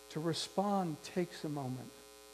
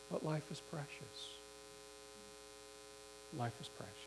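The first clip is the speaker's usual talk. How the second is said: hushed, retold gentle insistence; soft and gentle; 11 dB quieter than usual. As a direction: answer anger very gently and softly. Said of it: "kinda softly and gently".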